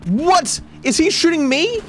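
very high, loud rising alarm; escalating panic; panicked cry. Real, not acted. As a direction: yelp as you're shot. Getting shot by boat.

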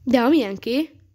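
ordinary delivery

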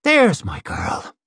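high, slow hill woman, wry again; edge back in voice. Acted. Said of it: "the edge back on her voice".

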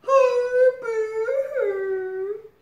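high, loud fake crocodile sobs; mock wailing. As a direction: wail like a big baby. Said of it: "wailed Crocodile".

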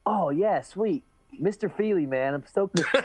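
quoting Sage's cheerful greeting before sparring; Sage impression. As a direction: hype it up like a giddy young fighter. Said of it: "he goes".

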